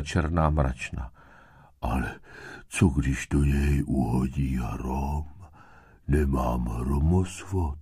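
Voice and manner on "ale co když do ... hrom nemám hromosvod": slow, low, worried snail voice; sighing. A narrator's reading. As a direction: sigh it out very slowly, fretting. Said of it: "vzdychal Šnek".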